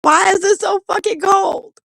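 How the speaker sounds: shouting at the freezing wind in disbelief; yelling at Mother Nature. Retold or imitated. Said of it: "yelling at Mother Nature".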